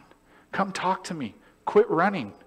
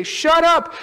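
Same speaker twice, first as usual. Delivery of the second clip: crowd snapping; 8 dB louder than usual. Retold or imitated. Crowd silencing him again.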